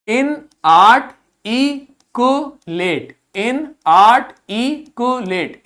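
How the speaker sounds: इनआर्टिकुलेट spoken very slowly, syllable by syllable; slow model reading. Shown as a demonstration. Teacher showing the class a new word, slow and deliberate. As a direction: draw out इनआर्टिकुलेट for pupils to repeat. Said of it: "फिर से पढ़िए इसको".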